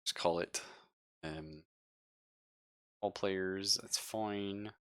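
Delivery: ordinary talk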